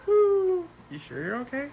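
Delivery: high, slow teasing concern. Retold or imitated.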